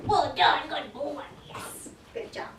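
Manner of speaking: usual speaking voice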